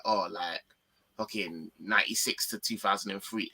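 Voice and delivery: low, soft, offhand mock quote; flippant imitation. Mimicking a friend naming Scholes's prime years, flippantly. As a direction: toss it off lightly, mimicking him. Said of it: "like, quite flippantly".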